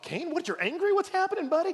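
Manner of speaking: God imagined baffled at Cain, mock clueless high voice. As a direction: play a dim, confused buddy. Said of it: "God's like".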